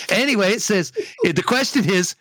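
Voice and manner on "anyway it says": ordinary delivery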